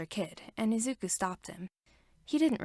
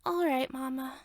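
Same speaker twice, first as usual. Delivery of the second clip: put-on higher, meek child voice; child agreeing with his mother; small boy; 5 semitones higher than usual. A narrator's reading. Boy gives in.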